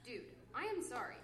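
ordinary talk, nothing marked

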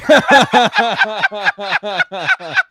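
loud, high burst of laughter; long, high-pitched belly laugh; hearty laugh. Real, not acted. Listener cracking up at the joke's punchline, hearty laugh.

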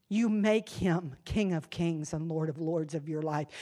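ordinary delivery

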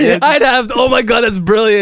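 high excited shout; delighted shout. Real, not acted. Delighted outburst.

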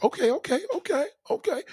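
high, rising excited okays; eager okay call. Retold or imitated. Man calling approval to a woman, high and eager. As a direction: hype her up, voice climbing. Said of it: "You could be like".

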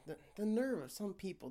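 normal talking voice

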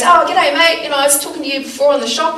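loud, high, fast g'day mate; husband hailing a stranger from the car, loud and bright; cheery Aussie greeting. Retold or imitated. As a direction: greet a stranger with a big grin. Voicing Michael greeting stranger.

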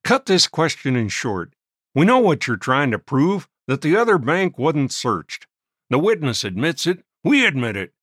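impatient judge. A narrator's reading. Judge scolding the prisoner.

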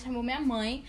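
usual speaking voice

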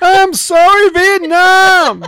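high loud stretched shout of Vietnam; mock DJ yell again. Yelling the DJ line again after being scolded. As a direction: belt Vietnam like a radio host. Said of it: "You made everybody deaf".

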